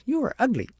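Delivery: put-on high, loud childish taunt; spiteful insult. A narrator's reading. Agnes hurls an insult at the mimicking child, high and loud. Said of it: "thought she was mocking her".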